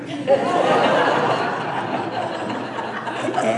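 breathy real laugh at his own punchline. Real, not acted. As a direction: let the laugh out after the joke.

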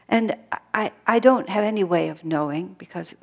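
ordinary talk, nothing marked

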